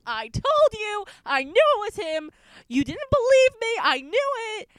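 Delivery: high, loud triumphant rant; gloating loudly to a doubting cousin; ranting and raving. Retold or imitated. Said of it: "ranting and raving".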